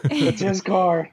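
high, slow mock wail; hammed-up panicky finger-pointing; panicked protest. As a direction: act out frantically shifting the blame. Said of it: "So I'm like".

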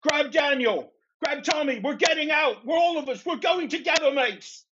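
high, desperate in-character screaming. Acted. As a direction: scream it high, pleading through a struggle. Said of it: "he just keeps screaming".

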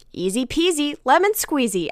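high, loud Build-A-Bear voice; automatic toy phrase. Retold or imitated. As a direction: chirp it like a squeezed talking toy. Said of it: "those automatic phrases".

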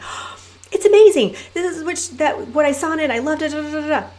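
high, bubbly retelling of her own raving; gushing excitement. Retold or imitated. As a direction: rave about the documentary like you can't stop. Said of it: "I'm like".

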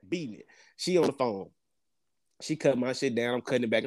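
normal talking voice

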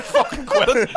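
high, laughing, telling her to quit; laughing plea. Retold or imitated. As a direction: laugh while begging her to stop. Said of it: "I was like".

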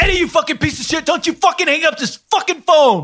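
high, fast, furious yelling. Acted. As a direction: scream at him down the phone. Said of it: "why are you yelling at me".

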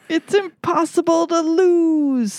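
high, slow ad-man pitch; infomercial. Pretend. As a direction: promise the moon like an infomercial. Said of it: "Sounds like an infomercial".

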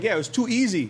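high casual quoted voice; imitating the trooper. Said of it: "He's like".

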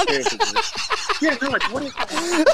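high-pitched, laughing through the words; real helpless laughter. Real, not acted. Golfer cracking up retelling his sprint, talking through laughter. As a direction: barely get it out for laughing.

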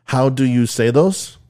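mock French exchange student, slow and halting; French accent. French student politely asking his math teacher for help, rough French accent. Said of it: "Sorry about the French accent there".

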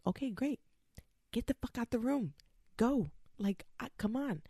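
high-pitched, exasperated brush-off; fed-up shooing. Retold or imitated. Patient in labor shoos the anesthesiologist, high and exasperated. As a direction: shoo him out with no patience left. Dismissing the anesthesiologist while in pain.

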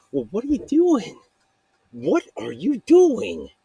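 high-pitched, badgering mock voice; high, exasperated nag; nagging heckler. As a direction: pester in a put-on high voice, drawing out the repeat. Acted nagging heckler questioning the speaker.

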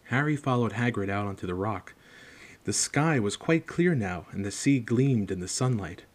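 plain reading voice

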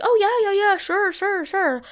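high, loud, breezy brush-off; mocking the teacher. Mimicking the guitar teacher brushing her off, high and loud. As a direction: ham up his careless yeah-sure.

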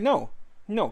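retold firm, high-pitched no; emphatic refusal. Refusing girlfriend's cats in his home, emphatic refusal.

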